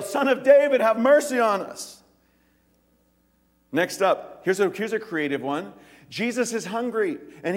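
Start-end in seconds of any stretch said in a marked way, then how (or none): 0.0-2.0 loud pleading cry, pitched high; yelling for mercy. Retold or imitated. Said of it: "They yell".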